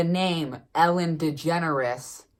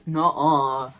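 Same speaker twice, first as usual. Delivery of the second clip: mock refusal. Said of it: "all the kids are like".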